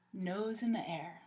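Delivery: ordinary delivery, nothing marked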